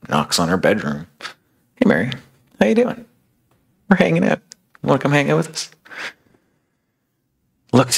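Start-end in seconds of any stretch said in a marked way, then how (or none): 1.8-5.7 much higher, bright, grinning invitation; friendly Jesus, light and high; cheerful greeting. Acted. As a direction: call her out to come play. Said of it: "he's grinning".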